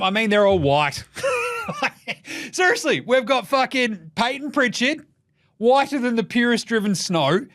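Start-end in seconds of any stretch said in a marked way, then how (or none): none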